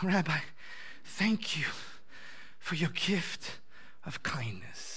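a mild imitation of a weeping man; retelling a grateful man's choked thanks; tearful thanks. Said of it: "he's in tears".

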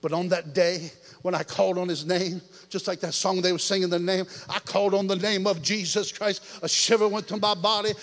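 ordinary talk

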